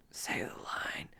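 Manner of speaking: whispered, very soft urging; hushed demand; whispered prompt. Acted. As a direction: whisper it like a cue from offstage. Quoting an eager demand.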